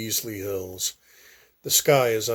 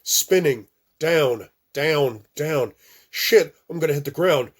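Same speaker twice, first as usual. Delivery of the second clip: panicked; 5 semitones higher, 6 dB louder than usual. Acted. Nightmare plane crash.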